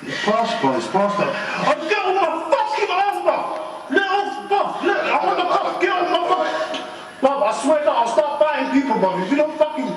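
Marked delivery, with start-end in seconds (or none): 1.3-9.9 shouting. Real, not acted. Detainee shouting while restrained.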